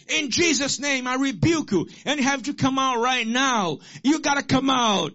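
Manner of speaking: high-pitched, forceful exorcism command; commanding rebuke. Retold or imitated. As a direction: act out a young man casting out a demon. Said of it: "he ran to the devil and said".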